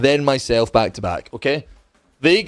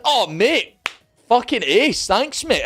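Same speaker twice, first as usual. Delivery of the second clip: put-on high, grateful Glasgow accent; 6 semitones higher, slower than usual. Student thanking the flat inspector, grateful Glasgow accent. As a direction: thank him in lifted Glasgow tones. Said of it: "it's the Glasgow accent".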